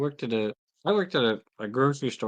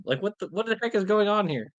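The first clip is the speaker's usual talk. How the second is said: usual speaking voice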